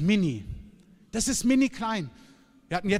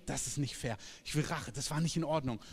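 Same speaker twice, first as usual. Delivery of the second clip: muttered quote; 5 semitones lower, 8 dB quieter than usual. Retold or imitated. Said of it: "wo Menschen denken".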